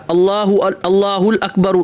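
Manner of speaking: الاکبر said with an added ال before اکبر; wrong al-akbar. Shown as a demonstration. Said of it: "اکبر کے بجائے الاکبر بولا". (اکبر کی جگہ الاکبر)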